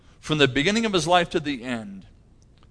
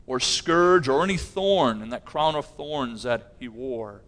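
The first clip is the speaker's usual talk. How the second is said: ordinary talk, nothing marked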